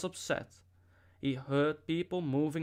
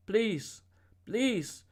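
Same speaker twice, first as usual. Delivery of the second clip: higher, pleading please, a touch of tears; crying plea; 7 semitones higher than usual. A narrator's reading. Boy begging the woman with the whip, crying plea. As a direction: beg the woman to stop, close to tears.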